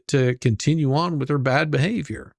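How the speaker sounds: ordinary talk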